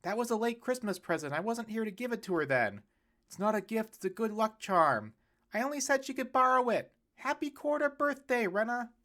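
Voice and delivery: each relative talking over the others; chorus of excuses. Acted. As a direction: relatives excusing their gifts. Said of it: "A chorus of excuses welled up".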